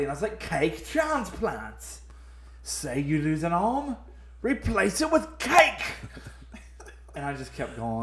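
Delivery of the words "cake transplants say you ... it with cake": put-on horrible British accent. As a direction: pitch it grandly in bad British. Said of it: "I was talking in this horrible British accent".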